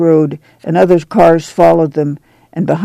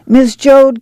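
high neighbour's call; 9 semitones higher, 3 dB louder than usual. A narrator's reading. Wainwrights calling to Mrs. Joad, high call. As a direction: call across to the next household, pitched up. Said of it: "came the call".

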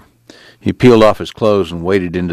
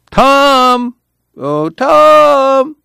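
called high and loud from afar; shrill call; 5 dB louder, much slower than usual. A narrator's reading. Ruthie calling Tom from afar, high and loud. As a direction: call his name from a distance, high. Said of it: "his name called shrilly".

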